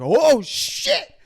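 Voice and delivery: high sudden "oh shit" double take; mock surprise. Retelling Elliot's double take, high surprised yelp. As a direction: jump up high in shock. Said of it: "and it's like".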